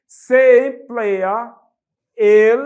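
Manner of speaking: "se emplea" slowed right down, "el" hit loud; emphatic slow. Shown as a demonstration. Teacher stressing which article to use, slow and loud. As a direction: stretch "emplea" and punch "el". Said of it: "ou então um".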